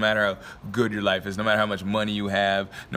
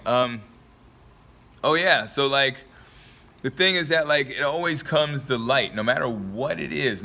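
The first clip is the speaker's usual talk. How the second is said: normal talking voice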